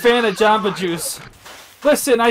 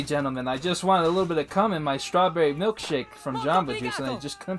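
subdued apology; 6 semitones lower, 6 dB quieter than usual. Pretend. Apologises after the outburst.

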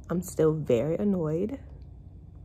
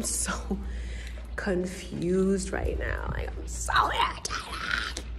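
slow, softer whispered complaint; 7 dB quieter, slower than usual. Real, not acted. Venting to viewers, slow whispered frustration.

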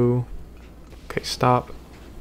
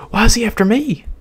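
frightened; 12 semitones higher, 5 dB louder than usual. Real, not acted. Chased by monster.

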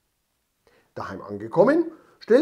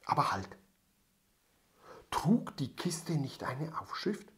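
whispering; 9 dB quieter than usual. A narrator's reading. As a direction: whisper the question with suspense. Suspense narration. (geflüstert, spannend)

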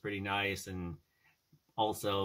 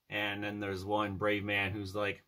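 usual speaking voice

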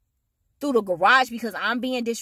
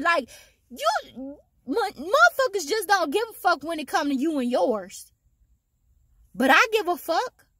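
a parent raging over a threat to the house; furious rant; 10 semitones higher than usual. Real, not acted. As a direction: let the anger climb and stay up. Furious rant about threats, kids.

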